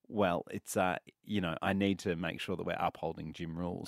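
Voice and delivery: low, quiet, hesitant retelling; hesitant staffer. Retold or imitated. Host imitating gym staffer's reply about upholding gym rules, low and hesitant. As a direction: imitate a subdued official reciting the rule.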